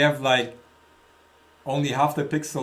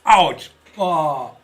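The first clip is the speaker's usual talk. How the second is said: sharp yelp of pain; loud real ouch from a burn; burned tongue; 2 semitones higher, 9 dB louder than usual. Real, not acted. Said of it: "I burned my tongue".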